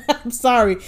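giggling through "I'm sorry"; laughing apology to listeners. Real, not acted. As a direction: apologize while still laughing.

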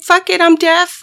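high, loud mock yell; mom yelling. Mom cutting into the recording, high loud yell. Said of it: "my mom was like".

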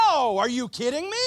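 a loud, incredulous retort; Paul with gusto. Retold or imitated. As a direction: scoff at the question, loudly. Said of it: "he would say it with a little more gusto".

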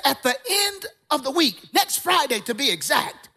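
imitated elder, high and mounting in force; strong-voiced old elder. Elder promising a young man jobs, high emphatic church voice. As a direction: mimic an old preacher's prophetic declaration. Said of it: "He had a s-, strong voice".

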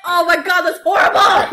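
loud cry of disgust; disgusted shout. Real, not acted. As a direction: yell at the awful taste. Tasting mixed drink.

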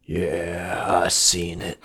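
a grizzled monkey mumbling from the back; gruff old monkey. Acted. Monkey in the background answers Anderson low and soft. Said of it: "He's like".

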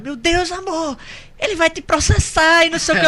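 put-on high alarmed girlfriend voice; mock panicked girlfriend. Imitating girlfriend's alarm at the fake lawyer. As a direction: squeal it like a panicked partner. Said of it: "a Alice, do nada, começou a dar corda pro cara".

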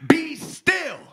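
high, loud command to the storm; commanding rebuke. Acted. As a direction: call out over the wind to quell it. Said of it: "he rebuked the wind".

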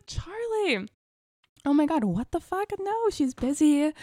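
high-pitched, mock-shocked scolding; scandalised protest. Wife to husband who wants to ask, scandalised protest.

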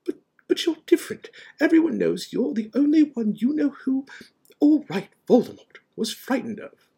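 very high, loud, flustered insistence; half exasperated, half admiring. A narrator's reading. McGonagall telling Dumbledore he's different, flustered admiration. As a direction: stammer at first, then insist emphatically. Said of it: "sounding half exasperated, half admiring".

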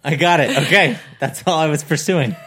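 talking through laughter; high, loud and giggling; laughing. Real, not acted. Laughing answer to the teasing.

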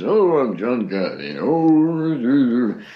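put-on grand old actor with swooping pitch; John Carradine. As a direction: intone it theatrically, swelling into long drawn-out vowels. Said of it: "he talks like this".

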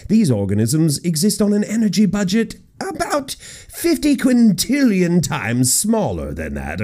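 a lightly put-on British accent, reading a quote; quoting the study in a mild British accent. Shown as a demonstration. Host quoting a scientist, mild British accent. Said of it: "let me do it in a British voice".

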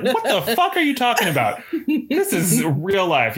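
exasperated crime boss, acted; incredulous boss. Boss tells pool-day dreamers this is real life, exasperated.

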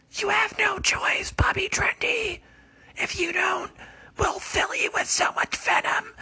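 a menacing snake leader whispering threats; high whispered snake voice. Acted. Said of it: "he was like".

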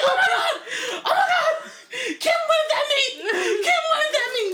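very high, loud laughing squeal; starstruck squeal. Retold or imitated. Fan squealing that Kim waved at her. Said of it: "And I was like".

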